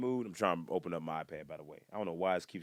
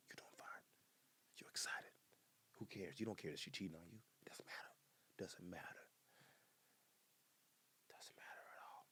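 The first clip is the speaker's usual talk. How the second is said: hushed, breathy lie to yourself; creepy whisper; 19 dB quieter than usual. Shown as a demonstration. Lying to himself that nothing matters, in a creepy whisper. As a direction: whisper it like you're hypnotizing yourself. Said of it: "anytime you whisper".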